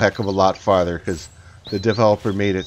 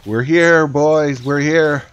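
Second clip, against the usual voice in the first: excited; 4 semitones higher, 5 dB louder, much slower than usual. Real, not acted. Arriving after a tough drive.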